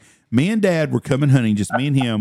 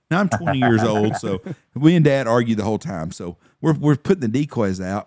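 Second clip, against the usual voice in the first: normal talking voice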